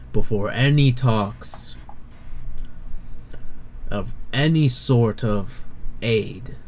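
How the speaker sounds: slow, weighty pronouncement with long pauses; firm and deliberate. Acted. Commander turns away and sets terms for the player, slow and firm. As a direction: lay down terms phrase by phrase. NPC lays down terms after pausing.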